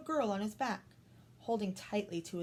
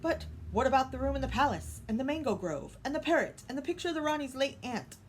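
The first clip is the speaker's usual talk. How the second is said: fussy protesting king; 5 semitones higher, 4 dB louder than usual. Acted. Raja protests fussily.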